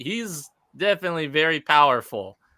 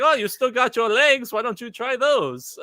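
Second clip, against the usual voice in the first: much higher, mock-helpful taunt; Bane; 10 semitones higher than usual. Villain toying with his beaten opponent, high mocking suggestion. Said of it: "Bane's all like".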